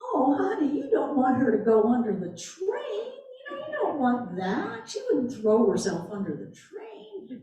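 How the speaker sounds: put-on honeyed Southern accent, lightly done; mild Southern belle voice talking an author out of tragedy. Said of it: "she had this great Southern accent".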